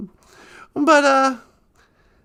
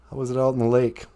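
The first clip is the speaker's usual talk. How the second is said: much lower put-on voice; deep co-host voice; 13 semitones lower, faster than usual. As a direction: ask like a deep-voiced sidekick.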